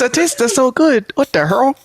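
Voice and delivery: Chinese grandpa voice. Retold or imitated. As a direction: drag it out like a grandpa. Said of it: "like a Chinese grandpa".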